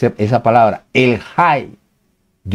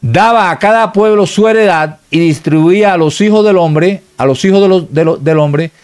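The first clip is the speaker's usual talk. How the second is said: raised, measured reading of a verse; scripture reading; 6 semitones higher than usual. Acted. Preacher reading scripture to the congregation, raised and measured. Reads Bible verse aloud.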